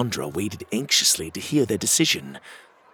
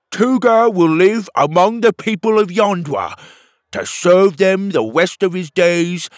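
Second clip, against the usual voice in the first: loud, high formal decree; proclamation; 8 semitones higher, 6 dB louder than usual. Acted. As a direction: proclaim the sentence. Petey proclaims the verdict.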